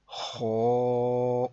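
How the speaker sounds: خو with a heavy rounded vowel, held long; full, heavy sound. Shown as a demonstration. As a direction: fill the mouth on خو and sustain it. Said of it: "اس کی آواز تھوڑی پر کی ہے". (خو موٹی آواز میں لمبا کر کے)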